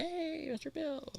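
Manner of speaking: high, soft, slow retold hail; drawn-out greeting. Streamer retelling how he greeted a friend at his truck, drawn-out soft greeting. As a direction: stretch out a high, quiet "hey" in greeting.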